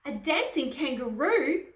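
high, loud storybook exclamation; acted crowd disbelief; astonished cry. A narrator's reading. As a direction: cry out in amazement. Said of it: "everyone cried".

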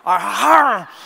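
loud growling ah ah through the teeth; mock growl. Biting the puppy's neck, loud mock growl. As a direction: growl like a mother dog, loudly. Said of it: "I just went".

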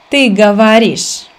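ordinary talk, nothing marked

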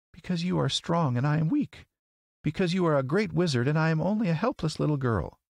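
put-on high, pleading little girl; Dorothy. A narrator's reading. Helpless girl begging the great wizard, pleading. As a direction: plead in a small girl's high voice.

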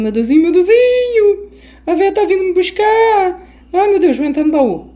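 high, loud panicked whimper; panicked wail. Real, not acted. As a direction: squeal in fear as she hides. Granny coming, panicking player. (choramingando em pânico)

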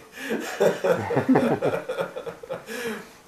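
loud, high, long laugh; hearty laugh. Real, not acted. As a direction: burst out laughing hard. Laughing at the story.

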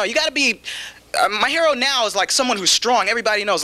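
normal talking voice

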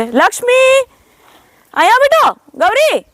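very high, carrying call; calling animals over. Real, not acted. Woman calling Lakshmi and Gauri over, high calling out. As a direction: call them in with a high, affectionate cry.

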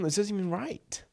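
under-the-breath whisper; a quick scornful whisper; whispered aside. Real, not acted. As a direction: whisper it to yourself in disbelief. Muttered aside while reading a message.

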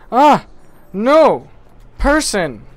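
loud, high cries of alarm; startled yell. Real, not acted. Player startled by a person in game, yelping. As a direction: yell out in surprise at a sudden obstacle.

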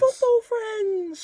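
high, slow, drawn-out tease; mock sing-song; teasing. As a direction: stretch it out to mock them.

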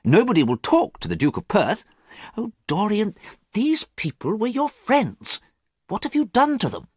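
a troubled friend, growing distressed; pleading friend. A narrator's reading. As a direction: list the scandals, then plead. Basil accuses Dorian.

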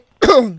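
harsh hacking cough; one loud sick cough; real cough. Real, not acted.

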